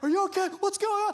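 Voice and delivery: high, urgent mock shout; panicked dad. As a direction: imitate an alarmed father checking on his kids. Panicked dad shouting over noise.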